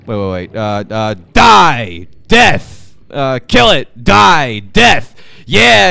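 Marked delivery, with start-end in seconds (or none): 1.3-5.9 battle yells. Real, not acted. Fighting a creature in game.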